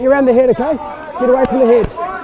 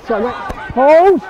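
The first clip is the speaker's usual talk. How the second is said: normal talking voice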